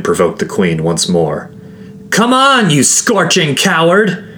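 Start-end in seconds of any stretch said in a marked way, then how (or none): 2.1-4.2 high, loud, drawn-out taunt; taunting shout. A narrator's reading. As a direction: goad the enemy at the top of your voice. Provoking the queen to fight.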